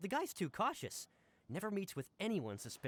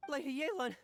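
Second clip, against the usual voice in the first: calling out higher and louder with relief; relieved call; 8 semitones higher, 3 dB louder than usual. Acted. Wu Pei calling out to Lady Yelan on finding her, relieved raised call. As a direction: hail her across the room, glad to have found her. Assistant finally finds his boss.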